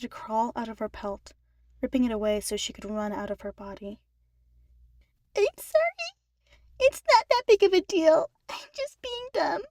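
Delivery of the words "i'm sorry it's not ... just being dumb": high, teary voice through sniffles; tearful little sister. A narrator's reading. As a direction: sniffle the apology, trying to hide the tears. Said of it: "Eleanor sniffled".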